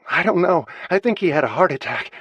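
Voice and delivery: high, loud, breathless panic; panicked. Acted. Daughter answering Thad mid-CPR, high and panicked. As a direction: blurt it out in panic while pumping his chest.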